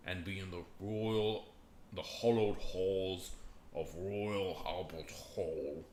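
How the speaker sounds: slow, thick mock Manchester accent; Davey Boy Smith. Streamer quoting a terrible wrestling interview, mock Manchester accent. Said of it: "he has a thick Manchester accent".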